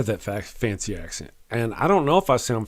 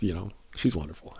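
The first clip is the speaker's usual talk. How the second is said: dropped much softer and lower, half to himself; quiet aside; 6 semitones lower, 11 dB quieter than usual. Real, not acted. Host to his audience about her, in a soft, low aside. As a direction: murmur it like a private aside.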